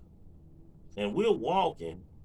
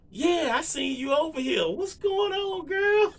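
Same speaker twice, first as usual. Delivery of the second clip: hammed-up smooth talk, pitched way up; mock-smooth greeting; 4 dB louder than usual. Playing it off smooth to the woman, exaggerated high greeting. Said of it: "I played it off so smooth".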